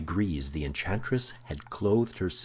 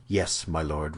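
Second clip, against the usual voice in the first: ordinary delivery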